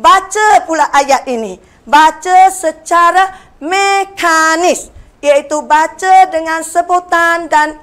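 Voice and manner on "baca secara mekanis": slow, stressed term. Shown as a demonstration.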